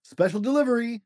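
calling out high at the door; shouting. Acted. Mailman calling to the Beavers at the door, shout. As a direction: announce it up to the house. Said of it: "shouts Mitchell".

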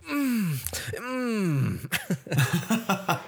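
high, drawn-out pleasured moan; imitating an overdone satisfied "mm"; mock orgasmic moan. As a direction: moan over a sip of water like it's too good. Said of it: "She drinks water and she's like".

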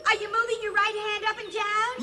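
high, loud delighted cries; thrilled at first sight of him; excited. Acted. Alien thrilled to see the human waving, high and loud.

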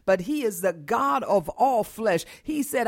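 normal talking voice

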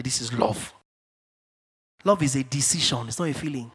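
ordinary talk, nothing marked

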